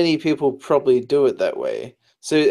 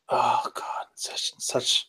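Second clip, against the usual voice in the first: breathless whispered groan; whispering; 7 dB quieter, much slower than usual. Real, not acted. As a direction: whisper it like painful memory. Groaning over confusing async memories.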